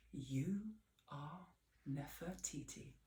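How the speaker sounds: soft, low, tender reminder; a dad's reassuring words recalled; gentle memory. Acted. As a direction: say it like a loving dad.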